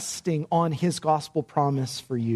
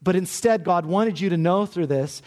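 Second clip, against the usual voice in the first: ordinary talk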